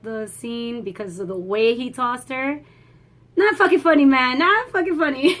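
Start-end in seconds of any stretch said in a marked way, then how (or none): 3.4-5.4 loud, high-pitched mock outrage; mock indignant protest. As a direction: protest loudly, half joking, before cracking up. Rebukes people who laughed at scene.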